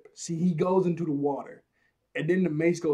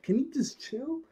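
real annoyed aside; 10 semitones higher than usual. Real, not acted. Talking to friend spamming invites.

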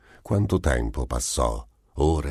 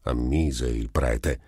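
usual speaking voice

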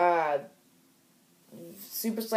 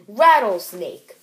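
mock bold announcement; 2 semitones higher, 11 dB louder, slower than usual. Character names his shape.

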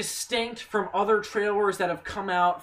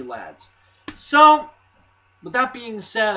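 normal talking voice

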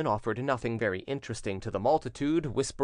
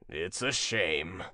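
low, very slow lament; 6 semitones lower, much slower than usual. A narrator's reading. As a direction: bemoan it slowly in a low voice. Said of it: "in a tone of lamentation".

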